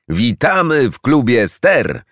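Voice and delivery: wyżej, powoli, wołane radośnie jak przez grupę; zawołaj na powitanie, przeciągając słowa; chóralne zawołanie. A narrator's reading. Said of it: "zawołała chórem grupa". (dzieci z klubu wołają chórem powitanie jeża)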